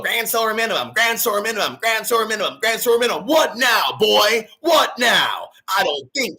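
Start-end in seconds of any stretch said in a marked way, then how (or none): 0.0-3.3 higher, sing-song mocking repetition; mocking critics. Mocking internet critics who bully him, sing-song. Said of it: "all these people go". 3.3-5.5 higher, loud taunt; taunting shout. Real, not acted. Taunting critics who were wrong, loud shout.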